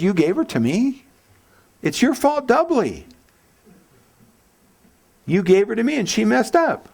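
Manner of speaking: high, whining blame-shifting Adam; whiny Adam. Retold or imitated. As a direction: whine it as Adam pointing at his wife. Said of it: "I love the way he says".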